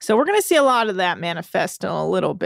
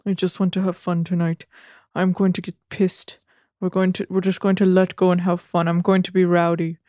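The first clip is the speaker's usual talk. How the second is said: impersonating Rob; 4 semitones lower than usual. Retold or imitated. Recapper voices castmate planning a night out, in low impersonation. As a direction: lower put-on monotone voice. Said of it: "and Rob's like".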